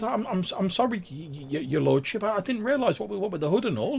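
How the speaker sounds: high, deferential stammer; Grimwald, squeaky apology. Acted. Sprite begs pardon of his lordship, squeaky and stammering. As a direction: stumble through a nervous squeaky apology. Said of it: "in, like, a really, like, squeaky, high-pitched voice".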